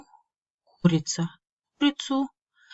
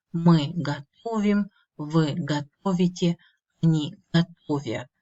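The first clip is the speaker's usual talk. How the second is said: готовлю, готовишь, готовит, готовим, готовите, готовят, all stressed on -то-; stress stays put. Shown as a demonstration. Teacher drilling the conjugation, готовлю stressed on -то- like готовить. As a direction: keep the weight on the middle -то- from готовлю to готовят. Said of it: "this stress stays as in the infinitive".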